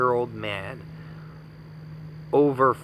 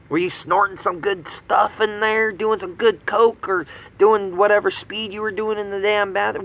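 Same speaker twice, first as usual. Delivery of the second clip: a hint of a higher, taunting voice; mocking; 7 semitones higher than usual. Taunting the teen about the bathroom. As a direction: needle him with a sneer.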